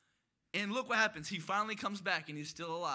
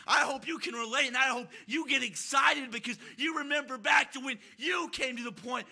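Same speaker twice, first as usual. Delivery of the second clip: raised, driving preacher's voice; impassioned preaching; 3 semitones higher, 4 dB louder than usual. Real, not acted. Preacher urging the saved to remember when they were lost, impassioned. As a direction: preach it out with mounting excitement.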